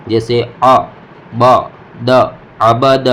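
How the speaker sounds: عبد as three short beats, "a-ba-da"; loud, unstretched syllables in عبد; quick short vowels. Shown as a demonstration. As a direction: cut آ، با، د short with no stretch. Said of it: "اس کو جلدی پڑھنا ہے".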